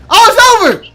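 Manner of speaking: loud, high-pitched outburst; excited shout. Real, not acted. Fan reacting to the wrestling finish, excited shout. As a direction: shout it as the match seems finished. Calling out during a wrestling match.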